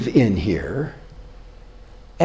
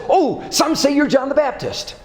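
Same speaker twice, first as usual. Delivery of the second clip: eager disciple; 10 semitones higher than usual. Retold or imitated. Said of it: "Andrew says".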